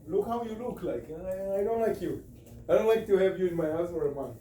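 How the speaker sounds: usual speaking voice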